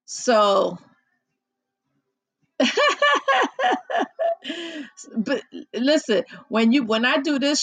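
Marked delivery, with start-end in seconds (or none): 2.6-5.1 high giggling laugh; real laughter. Real, not acted. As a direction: break into giggles.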